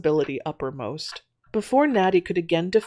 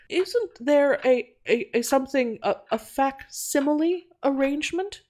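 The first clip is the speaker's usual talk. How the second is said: hesitant, stammering; 8 semitones higher than usual. A narrator's reading. Quimby asking Natty about a facsimile device, hesitant stammer. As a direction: grope for the word in a raised voice.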